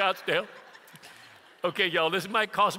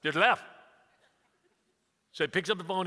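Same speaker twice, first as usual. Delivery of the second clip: usual speaking voice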